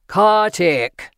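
deep powerful voice. A narrator's reading. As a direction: insist on an answer, booming. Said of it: "the voice repeated".

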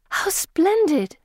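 high, slow marvelling exclamation; marvelling Pippa, high and drawn out; delighted. A narrator's reading. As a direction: savour the wonder slowly. Pippa marvels at glowing amulet.

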